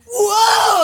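big, high, loud whoop; over-the-top whoa. Acted. Comic showing off to make Shooter laugh, over-the-top whoop. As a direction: belt out a hammed-up impression of yourself. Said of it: "I was a little over the top".

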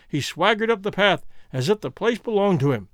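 ordinary delivery, nothing marked

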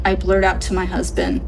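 ordinary delivery

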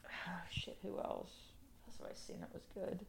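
breathy whisper to herself. Real, not acted. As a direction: whisper it under your breath.

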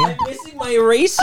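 high-pitched mock panic; panicking voice. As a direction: fake alarm over a lost eraser. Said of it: "You're panicking your voice".

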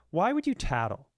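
usual speaking voice

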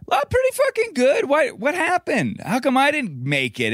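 high, exasperated self-pity, played for laughs; mock indignation. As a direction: whine it up high like you got robbed. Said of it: "I'm like".

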